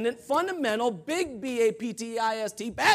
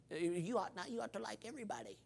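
very soft, halting voice of a reprover; hushed mock reproof; soft scolding objection; 12 dB quieter than usual. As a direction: voice a meek little reproach, barely audible. Voices a listener's timid objection.

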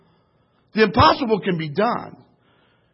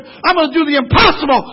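preached vow; 9 semitones higher, 9 dB louder than usual. Acted. First of repeated vow.

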